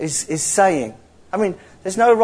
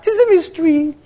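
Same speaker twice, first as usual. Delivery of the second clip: Scottish preacher impression; 10 semitones higher than usual. Imitating a favourite Scottish preacher for the congregation, high-pitched Scottish accent. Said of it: "He would say something like".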